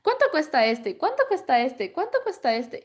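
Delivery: loud, rising mock eager kids; eager children. Schoolkids keep asking the vendor prices, in loud, climbing mock eagerness. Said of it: "le decían".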